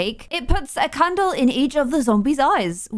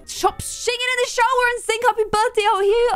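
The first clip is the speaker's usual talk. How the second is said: shouted much higher across the house; calling out; 11 semitones higher than usual. Real, not acted. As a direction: yell for the sim to come out. Yelling at sim in shower.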